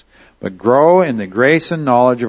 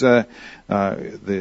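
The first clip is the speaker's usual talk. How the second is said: ordinary delivery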